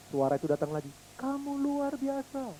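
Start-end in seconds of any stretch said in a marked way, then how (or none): 1.2-2.5 slow, very soft, distant; echo of praise. Pretend. Echo returning the praise, slow and faint. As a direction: let it come back slow and faint.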